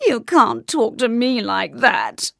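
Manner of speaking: slow, mildly tearful protest; lightly sobbed royal objection; sobbing king. A narrator's reading. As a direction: whine it slowly with wounded dignity. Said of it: "sobbed the king".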